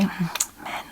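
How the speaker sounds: wry aside. A narrator's reading. Wife raising eyebrows about her husband, muttered aside. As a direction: say it under your breath, as a silent comment. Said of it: "as if to say".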